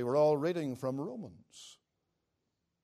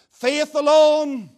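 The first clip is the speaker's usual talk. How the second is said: "Faith alone" drawn out, high and loud; emphatic repetition; 10 semitones higher, 12 dB louder, much slower than usual. Real, not acted. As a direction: hammer "Faith alone" slowly at full voice. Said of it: "especially that one".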